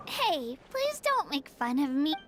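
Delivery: ordinary talk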